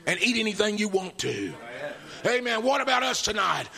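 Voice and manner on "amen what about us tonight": high, loud rousing preacher shout; preaching at full force. Acted. Preacher rallying his congregation, full-force shout. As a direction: whip the congregation up toward victory.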